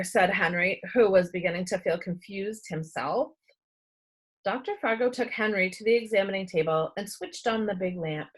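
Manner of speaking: plain reading voice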